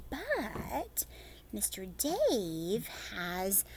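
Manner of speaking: normal talking voice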